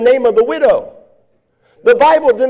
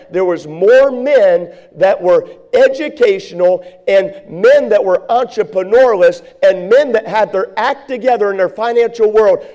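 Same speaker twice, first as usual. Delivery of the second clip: normal talking voice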